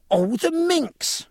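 very high, drawn-out exclamation; high, slow, scandalised outburst; scandalised cry. A narrator's reading. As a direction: cry it out as the outraged sister. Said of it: "cried her unmarried sister".

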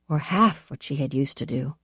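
ordinary delivery, nothing marked

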